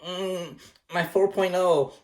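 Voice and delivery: high-pitched mock panic of a nerd; overly serious nerdy kids. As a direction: whimper like a devastated straight-A student, hammed up. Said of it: "they take it very serious".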